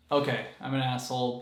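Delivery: ordinary talk, nothing marked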